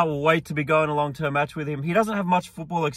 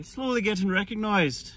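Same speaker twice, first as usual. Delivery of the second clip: higher-pitched, put-on Irish accent; 5 semitones higher, 4 dB quieter than usual. Shown as a demonstration. Vlogger joking about being recognised, put-on Irish accent. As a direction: say it in a mock Irish lilt. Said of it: "My Irish accent isn't the best".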